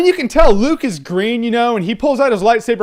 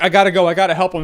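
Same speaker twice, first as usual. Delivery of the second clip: panicky rush; 2 semitones lower, faster than usual. Retold or imitated. Luke freaking out retold.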